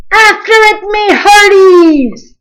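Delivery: high, loud, slow captain's order; a pirate captain barking an order; commanded. A narrator's reading. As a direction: command the crew to chase. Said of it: "Braidbeard commanded".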